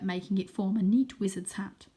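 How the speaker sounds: plain reading voice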